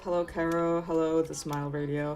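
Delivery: ordinary talk, nothing marked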